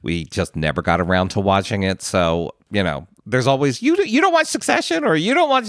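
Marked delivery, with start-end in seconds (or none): 3.8-5.6 squeaky high mocking whine. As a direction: parody a whiny fan, way up high. Mocking fans' complaints.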